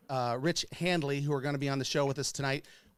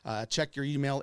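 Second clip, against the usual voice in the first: ordinary talk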